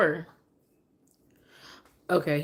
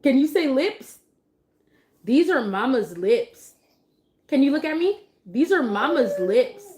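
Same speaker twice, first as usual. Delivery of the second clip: high singsong teaching; 7 semitones higher, 4 dB louder than usual. Shown as a demonstration. Mom coaxing her distracted baby to look at her lips. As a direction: sing it to win his attention.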